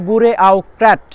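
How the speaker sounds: বুরে আউ ক্র্যাট said as spelt, ending ক্র্যাট; spelling pronunciation. Shown as a demonstration. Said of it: "এটা বলতে হবে". (বানান অনুযায়ী উচ্চারণ: বুরে আউ ক্র্যাট)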